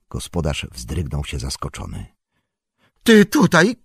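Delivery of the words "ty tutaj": high, loud startled cry; startled shout. Acted. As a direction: yelp it out in surprise. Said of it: "Krzyknął".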